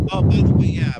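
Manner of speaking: breathing loudly into the mic; audible breaths on the mic; heavy nose breathing. Real, not acted. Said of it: "try not to breathe into the mic".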